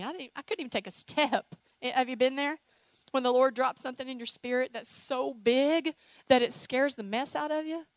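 ordinary talk

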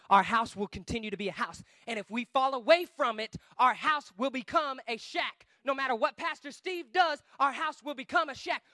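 ordinary talk

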